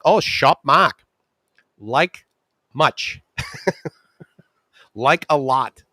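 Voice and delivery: put-on lightly accented Thai reply, slow; Thai answer. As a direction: say it simply like a Thai speaker. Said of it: "It's like".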